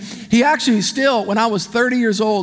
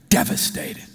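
usual speaking voice

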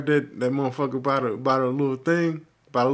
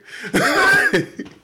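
loud high laugh of delight; delighted laughing burst; high burst of laughter; 6 dB louder than usual. Real, not acted. Laugh after free drink story.

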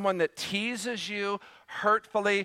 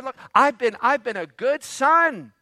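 put-on higher and louder, a wounded older son protesting; self-pitying complaint; 6 semitones higher, 3 dB louder than usual. Elder brother complains to his father, self-pitying and raised. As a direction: whine that you've been the good son all along. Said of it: "Again, self-pity".

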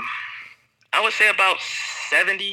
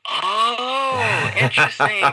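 high, drawn-out "oh, interesting" in a mock impressed local voice; 7 semitones higher, much slower than usual. Belgian local surprised by his Dutch, mock impressed and high. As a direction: play the surprised Belgian, pitched way up. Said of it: "They'd be like".

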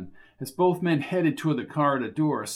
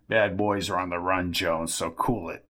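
put-on smug old man; 8 semitones lower than usual. [name] brushes Jones off.

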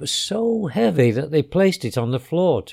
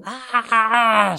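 high, drawn-out witchy shriek; storyteller's acted scream; witch's scream; 8 semitones higher, 4 dB louder than usual. A narrator's reading. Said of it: "screamed the witch".

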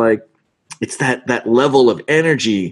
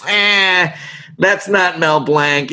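mock fan disapproval; 6 semitones higher, slower than usual. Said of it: "they're gonna be like".

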